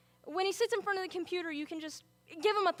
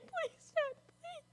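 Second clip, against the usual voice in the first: tearful plea; 11 semitones higher, 7 dB quieter, slower than usual. Acted. Father in crisis.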